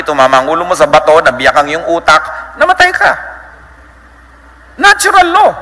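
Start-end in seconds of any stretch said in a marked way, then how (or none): 2.6-5.5 high, emphatic natural law; emphatic punchline. Acted. Preacher driving home natural law, high and emphatic. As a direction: land the point sharply.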